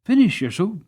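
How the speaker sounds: loud, high urgent protest; cried out. Acted. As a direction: call out to stop him in a rush. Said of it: "cried out".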